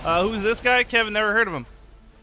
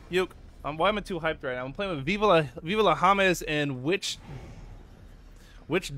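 usual speaking voice

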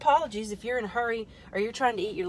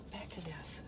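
soft unvoiced whisper; whisper to self; 3 semitones lower, 13 dB quieter than usual. Real, not acted. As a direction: whisper it as an aside. Muttering to self.

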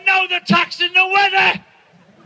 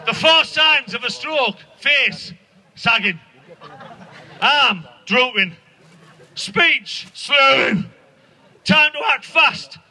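much lower, slow and solemn, building to a shout; mock stroke advert; 6 semitones lower, slower than usual. Comic mocking a health advert, deep booming announcer read. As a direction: boom the warning signs like a TV ad. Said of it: "Have you seen that one?".

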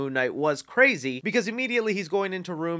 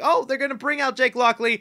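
high, loud excited exclamation; 9 semitones higher, 6 dB louder than usual. Retold or imitated. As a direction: gasp it out, thrilled. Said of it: "I was like".

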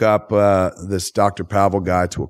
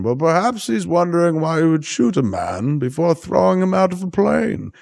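put-on higher, fussy old English gent voice; posh old British man; 10 semitones higher than usual. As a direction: sound like a fuddy-duddy British gentleman out of place. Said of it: "an old, posh, fuddy-duddy British man".

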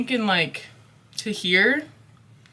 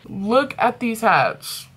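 slow showing off; 3 semitones higher, slower than usual. Real, not acted. Showing off hats again.

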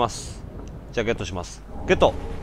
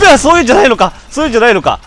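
high, loud shocked exclamation; loud surprise; 7 semitones higher, 10 dB louder, much faster than usual. Real, not acted. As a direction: caught off guard, shout it. Surprised by enemy move. (驚いて大声で)

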